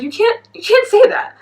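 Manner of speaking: high-pitched retold objection; indignant protest. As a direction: protest in scandalized disbelief. Retelling her protest at the podcast.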